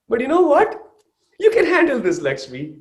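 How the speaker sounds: high-pitched, lilting self-encouragement; acted pep talk. Stressed mother coaching herself through baby's crying, acted pep talk. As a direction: lift the voice way up, then settle on the name.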